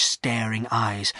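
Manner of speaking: ordinary delivery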